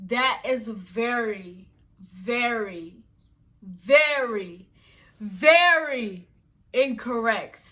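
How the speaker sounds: slow escalating very, very, very incorrect; building emphasis. Real, not acted. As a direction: stretch every very, climbing.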